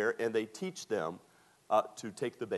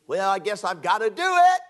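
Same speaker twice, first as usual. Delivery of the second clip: loud, high, reluctant sigh of a voice; mock grudging churchgoer, raised and loud; grudging giver; 7 semitones higher, 9 dB louder than usual. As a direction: say it like giving is a chore.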